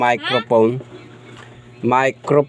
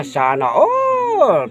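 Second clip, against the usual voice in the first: sing-song sana all; 10 semitones higher, much slower than usual. Pretend. Showing off new mic on stream.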